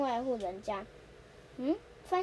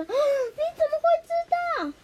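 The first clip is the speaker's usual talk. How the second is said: very high, loud shocked cry; 9 dB louder than usual. Acted. Suneo caught by Nobita, shrieking high and loud. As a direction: blurt it out, caught red-handed.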